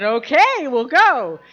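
high, loud, swooping okay; upbeat retold consent; eager agreement. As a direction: agree with gusto, pitch jumping up. Agreeing to go, acted quote.

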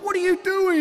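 high, loud frantic demand; exasperated outcry. Retold or imitated. Father confronts daughter in closet, loud high exasperation. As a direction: cry it out in hammed-up dismay.

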